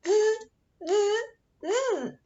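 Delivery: high buzzing monkey sound; monkey chatter. Acted. Monkeys mocking the peddler, high teasing buzz. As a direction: buzz it back like a cheeky monkey. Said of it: "and said".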